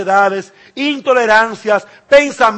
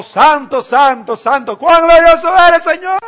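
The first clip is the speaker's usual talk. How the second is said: rising to a much higher, louder proclamation; exultant praise; 8 semitones higher, 7 dB louder than usual. Acted. Angels proclaim God's holiness, exultant and loud. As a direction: lift it up like heavenly worship.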